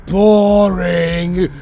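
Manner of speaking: a sudden loud, bored "Boring."; bored shout. Acted. Villain loudly dismissing the silent intruder, bored shout.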